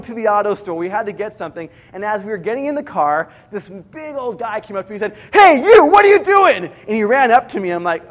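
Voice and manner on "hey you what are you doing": loud, high-pitched angry challenge; angry stranger's shout. Retold or imitated. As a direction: yell it like an irate man accosting someone. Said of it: "he was real angry".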